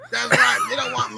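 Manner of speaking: mock squeaky high exclamation; squeaky affirmation. Mock-proud aside.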